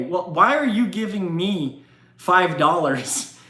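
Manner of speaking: ordinary delivery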